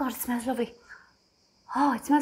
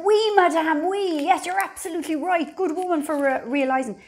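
imitating a gushing saleswoman, much higher and louder; eager French shop assistant; 7 semitones higher, 4 dB louder than usual. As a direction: play the delighted shop girl agreeing with everything. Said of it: "they were like".

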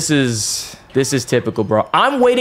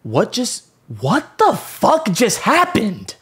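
shocked; 9 semitones higher, slower than usual. Real, not acted. Shock at skipped animation.

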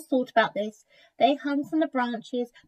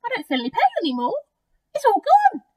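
high, excited crocodile voice; quick burst of delighted relief; relieved crocodile; 12 semitones higher, 7 dB louder, much faster than usual. A narrator's reading. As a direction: exclaim in surprised relief, rising louder. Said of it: "crocodile sat up and said".

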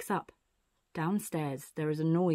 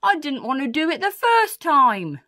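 loud, raised protest; storybook burglar objecting loudly; 7 semitones higher, 7 dB louder than usual. Acted. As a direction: exclaim it indignantly, pitched up. Burglar protests after hurting nose.

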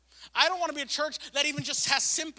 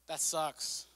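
pitying shrug; 12 semitones lower, 10 dB quieter, slower than usual. Retold or imitated. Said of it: "Sympathy is kinda like pity".

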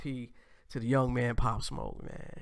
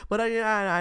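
burst of laughter; 8 semitones higher, 7 dB louder than usual. Real, not acted. Laughing at own joke.

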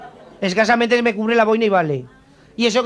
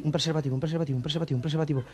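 much softer, lower, repeated under the breath; embarrassed mumble; 7 semitones lower, 11 dB quieter, faster than usual. Acted. Man asking a pharmacist for condoms, embarrassed mumble. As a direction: mutter it low, ashamed to be heard.

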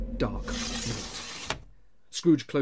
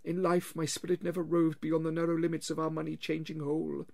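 wailing ghost; 7 semitones higher than usual. Acted. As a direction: drag each word out like a wail from the grave.

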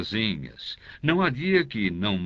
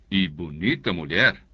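ordinary delivery

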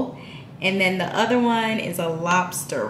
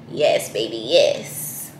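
much lower, hyping herself up; sassy self-hype; 10 semitones lower, slower than usual. Pretend. Praising her own look in the hat, low sassy hype. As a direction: drop low and egg yourself on.

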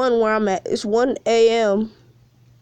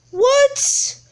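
a sudden squeak, much higher; shocked what; 14 semitones higher than usual. Real, not acted. Streamer startled by his game, high yelp.

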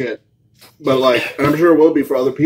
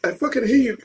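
high, teary complaint from the burn; real pained, higher-pitched grumble; near tears; 6 semitones higher than usual. Real, not acted. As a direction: curse your friends through watering eyes. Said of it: "Are you crying?".

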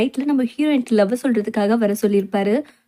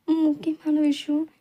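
slow, softer, higher puzzled echo of முக்கியமான; 3 semitones higher, 4 dB quieter, slower than usual. Acted. Heroine echoing Loli's words, puzzled. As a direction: repeat முக்கியமான slowly, unsure what's coming. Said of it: "ஒரு முக்கியமான விஷயம் சொல்லணும்".

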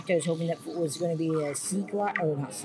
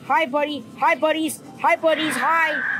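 nervous sing-song greeting; 8 semitones higher, 6 dB louder than usual. Real, not acted. Nervously greeting sea creatures.

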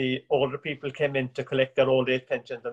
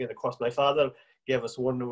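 normal talking voice

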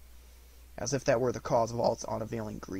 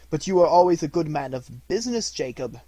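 ordinary delivery

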